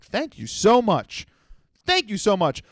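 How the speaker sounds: gushing thanks. Retold or imitated. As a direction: thank him like you're in heaven. Thanking the shop owner.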